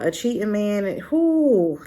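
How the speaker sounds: ordinary talk, nothing marked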